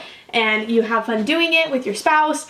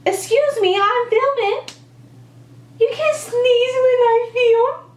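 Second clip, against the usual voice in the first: high, slow, sing-song mock scolding; 12 semitones higher, slower than usual. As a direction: tease the baby as if telling him off. Playful scolding of sneezing baby.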